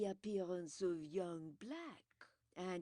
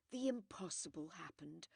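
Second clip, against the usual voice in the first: plain reading voice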